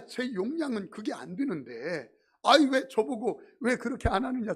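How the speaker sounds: ordinary talk, nothing marked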